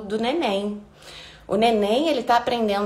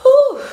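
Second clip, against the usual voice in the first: 1 hot exhale; 8 dB louder than usual. Real, not acted. Overheated after dancing on live.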